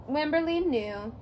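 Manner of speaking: ordinary delivery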